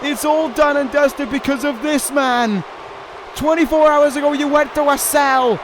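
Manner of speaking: ordinary delivery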